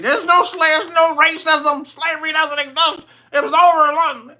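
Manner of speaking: high, loud mock denial of racism.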